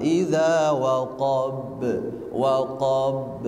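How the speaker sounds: "waqab" with a lengthened, swung vowel before the b; swaying isbah. Shown as a demonstration. Teacher shows the fault, "waqab" swayed and drawn out. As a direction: stretch and swing the "qab" in "waqab". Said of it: "kayak mengayun pada kalimat waqabnya begini".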